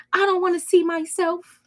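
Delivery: high mock-whining despair; mock their pitiful wailing, pitched high; whiny self-pity. Reader mocks ex wailing they can't face themselves, high whine.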